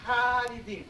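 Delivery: Khalidin repeated with throaty kh, khah-lee-deen; Arabic word. Shown as a demonstration.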